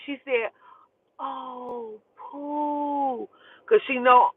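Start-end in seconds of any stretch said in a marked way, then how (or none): 1.2-3.3 soft, hollow, drawn-out words; imitated mouthing. Best friend mouthing the bad news to her, hushed imitation. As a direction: barely voice it, stretched and hushed. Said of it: "she mouthed it".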